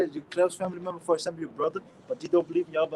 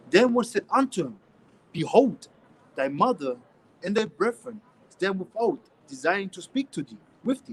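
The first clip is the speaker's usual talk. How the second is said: scripture reading; 5 dB louder than usual. Acted. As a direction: read the verse out like from the pulpit. Reads scripture aloud.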